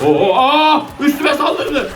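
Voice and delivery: sudden high startled cry; real fright, then a laugh; startled yelp. Real, not acted. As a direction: jump out of your skin. Zombie jumps on him.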